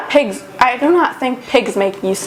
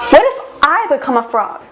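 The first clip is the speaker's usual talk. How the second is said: ordinary delivery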